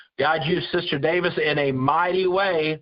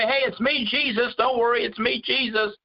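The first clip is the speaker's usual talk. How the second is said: much higher, light and breezy reassurance; chirpy and casual, the way he didn't say it; much higher mock-casual Jesus; mock chummy Jesus; 8 semitones higher than usual. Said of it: "He didn't say".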